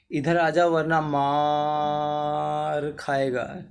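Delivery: slow call with मार dragged out long; calling out, stretched मार. Shown as a demonstration. Teacher acting a call to someone, stretched slowly. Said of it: "इस मार को इतना लंबा खींच दिया".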